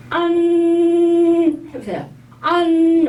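안 drawn out long, high and loud; slow 안 with a nasal end. Shown as a demonstration. Teacher modelling a long nasal 안. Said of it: "비음이 올린다고 생각하시게".

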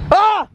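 sudden high fake yelp; fake scream. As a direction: pretend to fall with a yelp. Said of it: "Just kidding".

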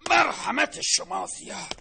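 sarcastic loud farewell. Acted. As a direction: fling the thanks back sarcastically. Creditor leaving curtly.

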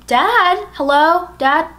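re-enacted call to see who's home. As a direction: call out for Dad into the house. Calling out to dad in house.

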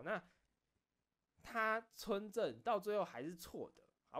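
ordinary talk, nothing marked

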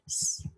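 S as a soft, drawn hiss; soft C sound. Shown as a demonstration. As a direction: say S as the soft hissing consonant, not the letter name. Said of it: "say its soft sound".

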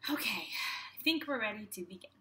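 usual speaking voice

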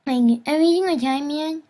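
high-pitched annoying squeak; squeaker voice. Shown as a demonstration. Showing viewers the verbal habit, high squeaker voice. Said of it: "when I use my s".

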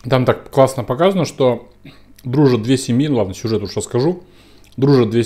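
talking between bites of food; chewing while speaking; mouth partly full. Real, not acted. Seen 1: Ate a bite at -4s; cheeks still working while talking.

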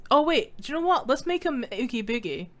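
ordinary delivery

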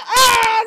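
loud, high yell of dismay; frustrated yell. Real, not acted. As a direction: cry out as the game beats you. Killed by double nets.

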